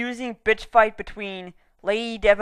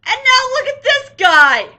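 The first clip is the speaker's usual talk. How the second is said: exasperated outburst; 11 semitones higher, 5 dB louder than usual. Real, not acted. Mock outrage at new villain.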